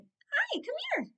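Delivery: very high, coaxing call; pet-calling voice. Real, not acted. Vlogger calling a pet over, high coaxing voice. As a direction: coo it way up high.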